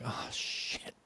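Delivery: hushed, breath-only self-quote; whispered dread. Retold or imitated. Teacher told "I gotta talk to you", whispers in dread. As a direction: whisper it as a sinking reaction. Retells own dread at ominous words.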